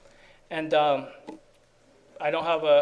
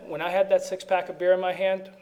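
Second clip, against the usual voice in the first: normal talking voice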